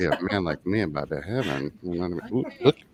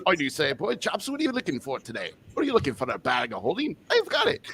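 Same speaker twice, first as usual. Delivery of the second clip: high, bright Australian shopkeeper voice up in the higher registers; Australian shop owner; 7 semitones higher than usual. Pretend. Nick greets a customer in a high Australian accent. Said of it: "the Australian".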